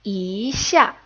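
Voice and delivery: "yi" drawn upward, then "xia" falls sharply; second tone yi. Shown as a demonstration. Teacher shows the changed tone, "yi" rising. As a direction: let "yi" rise into a falling "xia". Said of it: "gets changed into the second tone".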